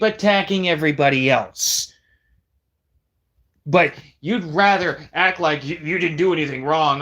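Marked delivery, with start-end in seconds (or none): none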